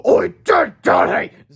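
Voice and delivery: high, loud monster. A narrator's reading. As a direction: growl out orders like a beast. Turbo Toad angry.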